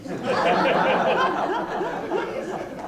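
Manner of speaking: long unvoiced high laugh; breathy laugh. Real, not acted. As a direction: wheeze with laughter. Laughs at the gate joke.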